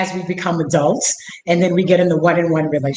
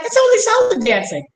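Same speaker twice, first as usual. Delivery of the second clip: mocking people; 13 semitones higher than usual. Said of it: "most people are like".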